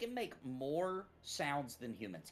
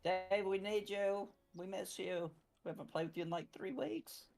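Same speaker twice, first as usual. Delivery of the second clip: pleading; 8 semitones higher than usual. Pretend. Guilt-tripping absent friend.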